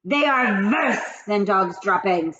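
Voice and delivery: slow witch screech. Acted. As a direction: sneer it slowly. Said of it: "screeched the Grand High Witch".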